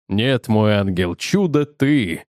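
a lovesick groom cooing back to his bride; doting protest. A narrator's reading. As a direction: insist sweetly to your darling. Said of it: "возразил Чаффи".

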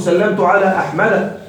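أحمد ending in a short a, "Ahmada"; fatha case ending. Shown as a demonstration. Teacher showing a diptote after a preposition, أحمد said as "Ahmada". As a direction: sound the final fatha on أحمد. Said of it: "هذه تجر بالفتح".

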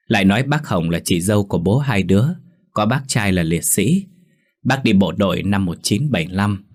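ordinary delivery

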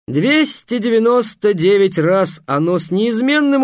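loud, high and slow showman boast; booming circus ringmaster. Acted. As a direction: proclaim the number grandly. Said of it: "торжественно сказал". (громкое торжественное хвастовство)

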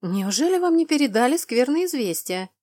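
theatrical, put-on distress; feigned dismay. A narrator's reading. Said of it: "наигранно вздохнула я".

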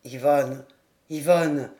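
put-on low, sobering drunk; dazed drunkard repeating. A narrator's reading. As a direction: repeat the name heavily, struck by it. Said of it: "il répétait".

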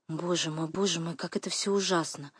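very soft horrified murmur; whisper-soft lament to yourself; quiet, shocked distress; hushed dismay. A narrator's reading. Катя одна, в ужасе.

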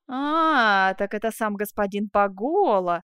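drawn-out, mocking realisation; slow, contemptuous muttered comment; scornful. A narrator's reading. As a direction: stretch it out with disdain. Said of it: "задетая презрительным тоном Челлини".

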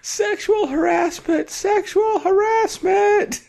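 very high, drawn-out mock shout; hammed-up cry of alarm, stretched out; mock scream. Crying out a fake accusation from the ground, mock scream. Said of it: "drop to the ground and scream".

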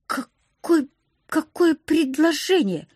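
high, stuttering bewilderment; alarmed stammer. A narrator's reading. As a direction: stumble on the first word, high and flustered. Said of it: "я уже заикаться начала". (растерянное заикание высоким голосом)

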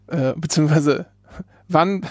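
ordinary talk, nothing marked

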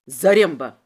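loud low call; forceful, low-pitched shout; barked summons. A narrator's reading. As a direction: bark the name as an order. Inspector orders his henchman.

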